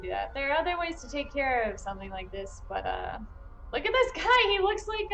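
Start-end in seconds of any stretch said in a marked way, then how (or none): 3.7-5.1 high, loud amused surprise; surprised. Real, not acted. Spotting a strange prisoner on screen, real surprise. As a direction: pitch jumps up at the odd sight. Spots a hooded figure in game.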